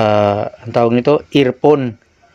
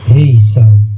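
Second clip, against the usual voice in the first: mic close to mouth; 4 semitones lower, 7 dB louder than usual. Shown as a demonstration. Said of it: "ilalagay ko na yung mic sa bibig ko".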